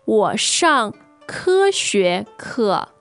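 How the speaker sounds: ordinary talk, nothing marked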